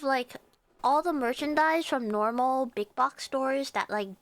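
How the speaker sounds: usual speaking voice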